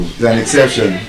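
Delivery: usual speaking voice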